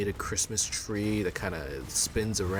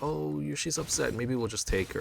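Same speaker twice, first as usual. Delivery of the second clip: polite worried parent; 8 semitones higher than usual. Retold or imitated. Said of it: "we're like".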